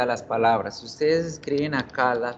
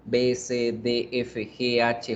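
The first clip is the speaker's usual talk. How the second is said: ordinary delivery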